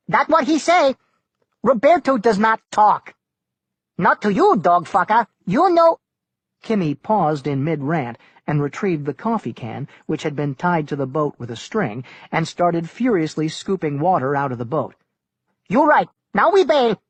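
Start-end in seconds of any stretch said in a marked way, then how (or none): 0.1-6.0 high, loud pidgin insults, hurt and angry; Kimmy the navigator. A narrator's reading. Kimmy quitting on Tuck, loud and high insults. 15.7-17.0 high, loud pidgin-English navigator berating the pilot; Kimmy the navigator. A narrator's reading. Kimmy scolding Tuck over the storm, loud and high.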